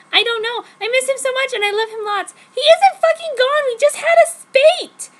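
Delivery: upset, rising to a high furious yell; tearful then blowing up at the pillow. Acted. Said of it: "I yelled and punched my pillow".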